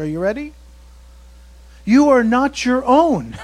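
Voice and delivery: usual speaking voice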